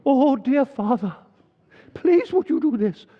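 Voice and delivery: mock the churchy put-on praying voice; mock special prayer voice. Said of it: "You have to put on a very special voice".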